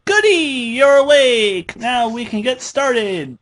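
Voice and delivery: high, loud and bouncy Pinky; gleeful. A narrator's reading. Pinky greets her captive, gleefully high and loud. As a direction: chirp it out with delight. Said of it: "stated gleefully".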